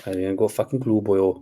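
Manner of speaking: gruff put-on Welsh accent. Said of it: "little Welsh accent".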